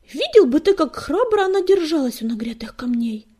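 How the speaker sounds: high, loud, excited praise; loud exclamation. A narrator's reading. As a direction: burst out proudly about your friend. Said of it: "громко воскликнул Белохвост".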